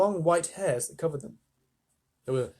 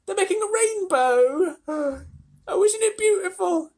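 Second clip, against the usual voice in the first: much higher, loud, with a gasp; delighted awe; 6 dB louder than usual. A narrator's reading. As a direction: gasp and gush over something beautiful. Travellers marvel at the rainbow.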